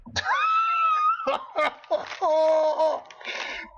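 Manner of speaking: very high, loud crying laugh; shrieking laugh. Real, not acted. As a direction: scream with laughter. Laughing at own crazy remark.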